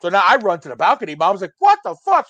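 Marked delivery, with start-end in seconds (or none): 1.6-2.3 high mock shriek of alarm; imitating freaked-out mom. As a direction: act out mom freaking out, pitched way up. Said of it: "So she's freaking out".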